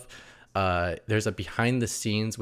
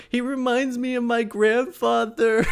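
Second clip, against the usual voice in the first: mock weepy old lady. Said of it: "runs to Sandra going".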